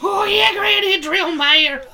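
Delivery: put-on high, breathy old granny voice. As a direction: squeal it like a giddy old granny. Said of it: "I went to Granny voice".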